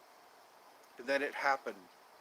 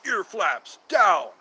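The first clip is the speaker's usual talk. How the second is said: loud slow announcement; 5 semitones higher, 12 dB louder, much slower than usual. Real, not acted. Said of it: "I need to put down my ear flaps".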